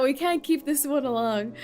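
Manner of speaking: ordinary talk, nothing marked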